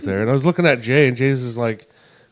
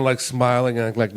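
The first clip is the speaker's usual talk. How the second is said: ordinary delivery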